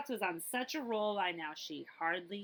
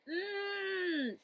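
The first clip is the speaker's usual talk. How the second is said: hungry mmm; 11 semitones higher than usual. Acted. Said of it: "said Goldilocks".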